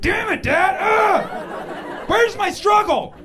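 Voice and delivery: very high, loud comic outburst at a parent; mock exasperated shout. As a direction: wail in hammed-up frustration at dad. Acted frustration at supportive dad.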